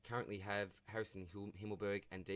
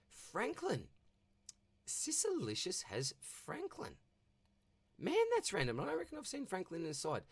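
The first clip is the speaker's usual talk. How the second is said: much higher, stretched-out disbelief; high-pitched amazed double take; surprised disbelief; 10 semitones higher than usual. Real, not acted. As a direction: react as if you can't believe it. Shocked at the rival's team pick.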